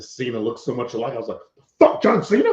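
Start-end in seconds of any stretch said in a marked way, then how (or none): 1.8-2.5 high, loud playful curse; mock angry outburst. Fan retells confusing Channing Tatum for John Cena, mock outburst. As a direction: bark it in fake outrage.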